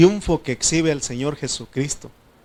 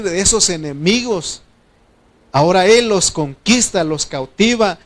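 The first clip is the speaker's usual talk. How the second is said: preaching at full force; 3 semitones higher, 5 dB louder than usual. Acted. Preacher builds the point again.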